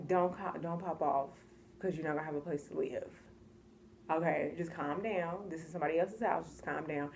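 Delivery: low, soft, holding back; calming self-talk. Retold or imitated. Talking herself down from popping off, low and calming. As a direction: talk yourself down under your breath. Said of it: "I calmed down".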